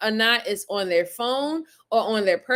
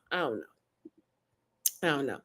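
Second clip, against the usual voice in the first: quiet despair; 9 semitones lower, 10 dB quieter, much faster than usual. Real, not acted.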